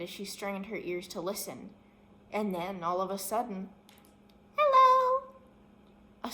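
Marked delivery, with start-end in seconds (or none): 4.6-5.3 very high, loud chipmunk cry; high squeaky call. Acted. Small hidden chipmunk calling out to the girl, squeaky and loud. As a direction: squeak it out like a tiny animal.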